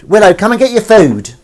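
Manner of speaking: loud, high call to the cat; shouting for Willow to come eat. Real, not acted. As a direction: call out across the house.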